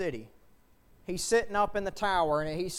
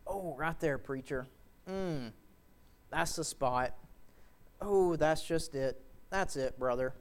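low, soft, savouring each phrase; contented churchgoer; 4 semitones lower, 4 dB quieter than usual. Acted. Congregation member to preacher scratching their favourite spot, mock contented. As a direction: sigh it out like a scratched itch.

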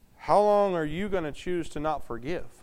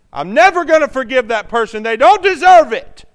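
loud, higher, indignant vow; bitter refusal; 7 semitones higher, 13 dB louder than usual. Retold or imitated. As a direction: play the bitter one who won't forgive. Voicing a bitter churchgoer.

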